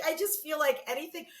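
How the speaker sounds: usual speaking voice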